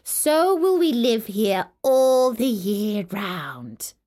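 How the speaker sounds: loud, slow icy voices; snow and frost. Acted. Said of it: "they cried".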